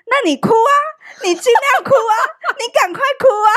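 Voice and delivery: high-pitched, exaggerated retelling of a husband's taunt; mock goading. Retelling husband's answer to her tears, mock goading. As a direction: egg them on, mockingly, pitch way up.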